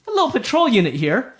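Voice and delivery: high-pitched gnome hello; gnome greeting strangers. Acted. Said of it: "they start taking note of you guys".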